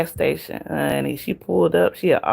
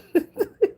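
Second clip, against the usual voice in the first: high laugh. Real, not acted. Laughs at V's cussing.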